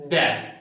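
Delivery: "the" said short and open, without the long ee; short, unlengthened vowel in "the"; weak form of the. Shown as a demonstration. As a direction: say "the" as a short thuh. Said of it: "this word is pronounced".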